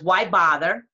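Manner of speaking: normal talking voice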